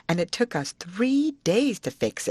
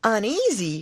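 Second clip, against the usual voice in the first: put-on higher, eager old-woman voice; Aunt Sally's voice; 4 semitones higher than usual. Aunt to the boy, eager old-woman voice. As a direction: coax the boy for all the news.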